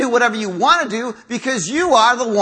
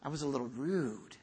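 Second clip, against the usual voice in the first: very soft, low and sheepish; mild, downplayed confession; 7 semitones lower, 17 dB quieter than usual. Man admitting he was rude to his wife, mild. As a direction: minimize it in a hushed, low voice. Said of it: "we kind of, uh, mild it".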